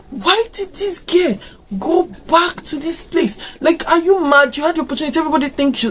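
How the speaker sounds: high-pitched, incredulous scolding; exasperated disbelief. Acted. Viewer berating a film character for going back, exasperated. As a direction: demand an explanation, pitched well up in disbelief. Acting out her reaction to film.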